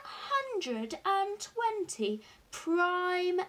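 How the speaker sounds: loud, high, slowly drawn-out proclamation; grand, delighted announcement; triumphant elation. A narrator's reading. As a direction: relish every word of the boast. Father gloats over the triumph.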